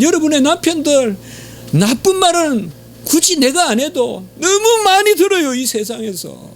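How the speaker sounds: fervent sermon peak; impassioned preaching. Acted. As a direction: plead with the congregation from the pulpit. Preaching climax.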